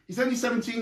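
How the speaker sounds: ordinary delivery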